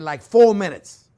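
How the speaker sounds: drawn-out loud emphasis; emphatic boast. Real, not acted. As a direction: lean on it proudly. Boasting of a TV demo speed.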